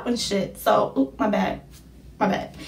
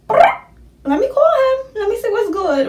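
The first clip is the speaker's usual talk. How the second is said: eager; 12 semitones higher, 4 dB louder than usual. Retold or imitated. Eager decision to call boyfriend.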